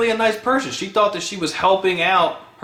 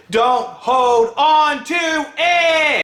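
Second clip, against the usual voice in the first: drawn-out shouted warning; shouted plea; 6 semitones higher, much slower than usual. Real, not acted. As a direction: yell it at them so it sticks. Urging viewers, shouting.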